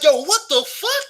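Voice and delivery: high, slow, incredulous yo; stunned disbelief. Retold or imitated. Narrator reliving the girl walking off, high disbelieving cry. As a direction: replay the moment she walked off, baffled. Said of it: "I was like".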